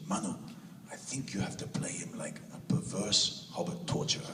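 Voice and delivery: imitating his German manager in a hushed, breathy German accent; a conspiratorial German suggestion. German manager advising him on the role, in a hushed German accent. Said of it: "She's got this wonderful German accent".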